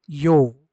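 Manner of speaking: यो called out loud as "yo"; loud demo of यो for O with umlaut; Ö sound. Shown as a demonstration. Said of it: "क्या बोलेंगे".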